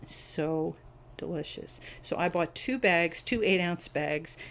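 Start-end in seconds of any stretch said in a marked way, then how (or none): 0.1-1.8 "delicious." much softer, the final s held long; a soft, lingering, dreamy delicious; savouring. Real, not acted. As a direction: let "delicious." melt away quietly, savouring it. Repeats the praise, savouring it.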